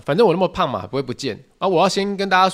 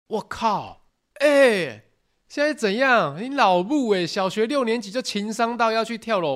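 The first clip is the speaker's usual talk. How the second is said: shocked outburst; 8 semitones higher, slower than usual. Real, not acted.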